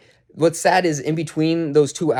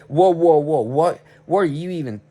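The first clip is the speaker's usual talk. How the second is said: higher, sputtering retold protest; taken aback; 4 semitones higher than usual. Worker answers foreman's sudden accusation, startled sputter. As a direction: blurt startled objections, cutting yourself off.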